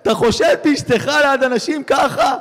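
an offended wife wailing at her husband; hammed-up weeping outburst; crying wife. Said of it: "היא מתחילה לבכות".

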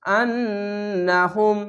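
আন্নাহুম slowly, with a long hummed double N; obligatory ghunna. Shown as a demonstration. Teacher modelling wajib ghunna, a drawn-out nasal N. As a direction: hold the N of আন্নাহুম in the nose. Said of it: "ওয়াজিব গুন্না".

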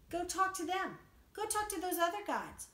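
a scornful voice of God sending Israel away; mock God rebuke. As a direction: send them off to their other gods scornfully.

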